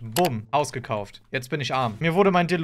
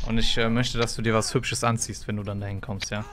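sleazy suitor voice; 5 semitones lower than usual. Acted. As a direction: play the creepy client low. (schmieriger Freier)

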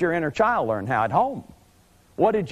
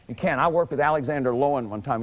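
ordinary talk

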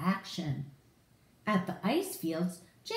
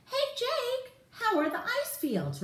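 much higher, bright hello; cheery greeting. Acted. Ryder calling Jake on the video screen, cheery. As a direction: call out a friendly hey.

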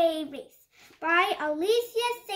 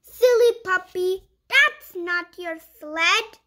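lively picture-book read-aloud; reading to children; 5 dB louder than usual. Acted. Reading a puppy picture book to young viewers, bright storytime voice. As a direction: read it like storytime for little kids.

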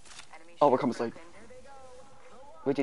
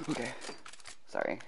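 ordinary delivery

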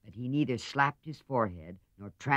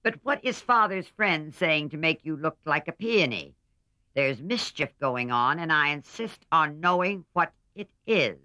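higher and louder, demanding; stern old lady; 6 semitones higher, 8 dB louder than usual. A narrator's reading. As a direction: insist like a bossy old aunt. Aunt March demanding.

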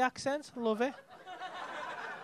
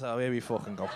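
low, imitated disgruntled punter; mock fed-up aside, pitched right down; fuming grumble; 13 semitones lower, 6 dB quieter than usual. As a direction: grumble it low, as if quoting his thoughts. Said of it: "you look fucking fuming there".

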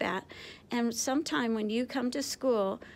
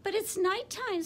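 much higher squeaky little bat voice; squeaked; 9 semitones higher, 4 dB louder than usual. A narrator's reading. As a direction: pipe it up, small and worried. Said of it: "Stella Luna squeaked".

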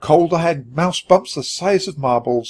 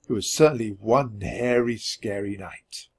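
spooky hush; 3 semitones lower, 7 dB quieter than usual. A narrator's reading. Spooky title callback.

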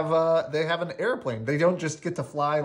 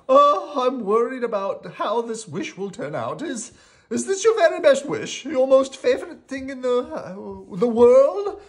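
high, worried little character voice; meek worry, pitched way up; 11 semitones higher, 7 dB louder than usual. Acted. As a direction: ask anxiously in a high, timid voice. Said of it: "she asked us meekly".

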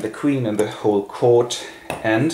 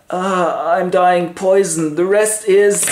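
high, loud theatrical agony; hammed-up last words, cut short; dying Hamlet; 8 semitones higher, 4 dB louder, slower than usual. Hamlet's acted death.

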